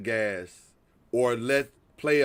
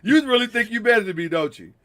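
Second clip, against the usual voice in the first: acted-out challenge to a rival; lightly voiced indignant player; imitating frustrated player; 5 semitones higher, 3 dB louder than usual. Retelling a player's thoughts after the three, mock frustrated challenge. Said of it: "Mikal seemed so frustrated".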